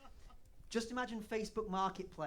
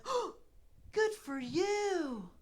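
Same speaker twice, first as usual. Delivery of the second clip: put-on very high, slow, drawn-out American accent; syrupy American congratulation, stretched right out; gushing American; 13 semitones higher, 4 dB louder, much slower than usual. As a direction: squeal it like an over-supportive American. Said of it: "you tell an American person".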